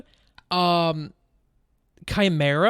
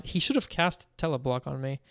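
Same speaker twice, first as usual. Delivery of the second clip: usual speaking voice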